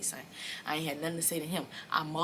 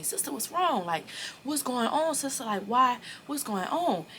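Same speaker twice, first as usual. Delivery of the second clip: higher-pitched imitation of an anxious friend; urgent questioning; 8 semitones higher, 3 dB louder than usual. Friend pressing her about what's wrong, urgent questioning. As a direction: pester with raised, insistent questions.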